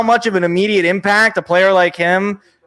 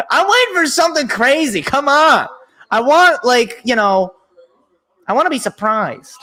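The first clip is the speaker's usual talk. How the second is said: high, impatient plea; eager, high-voiced urging; excited pleading; 7 semitones higher than usual. Real, not acted. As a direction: beg for some drama, pitch way up. Eager for a surprise pick.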